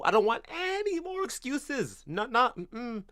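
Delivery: high-pitched, stretched-out protest; exasperated refusal. Real, not acted. Streamer rejecting Arsenal's excuses, exasperated refusal.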